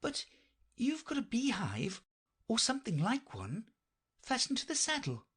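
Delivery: high-pitched, puzzled questioner; Alice. Acted. Alice points out the knight's beehive, high polite voice. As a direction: lift the voice high for a polite, observant listener.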